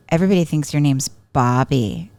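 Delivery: sly, needling tease; taunting. Retold or imitated. Sister needling her brother about his name, taunting. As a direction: put some stank on the name. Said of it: "the way you said that, I knew that you could put stank on that pitch".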